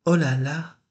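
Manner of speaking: léger cri de surprise. A narrator's reading. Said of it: "s'écria-t-il".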